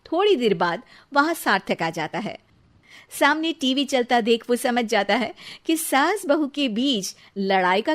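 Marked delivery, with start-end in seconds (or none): none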